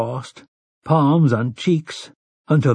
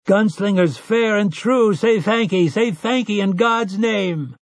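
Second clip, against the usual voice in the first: higher, ringing rustic cheer; crowd's fervent call; 9 semitones higher than usual. Acted. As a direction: call it out to the gunslingers like a blessing. Crowd calls out blessing.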